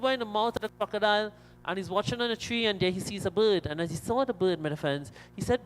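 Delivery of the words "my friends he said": ordinary talk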